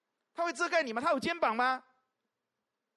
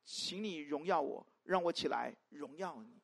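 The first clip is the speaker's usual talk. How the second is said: 1 reverent recitation; 6 semitones lower, slower than usual. Shown as a demonstration. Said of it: "耶稣祷告你可以背啊".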